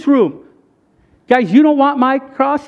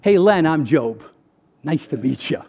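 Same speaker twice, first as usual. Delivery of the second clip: playing Job; 6 semitones lower than usual. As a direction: introduce yourself in a low, easy voice. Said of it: "gonna be like this".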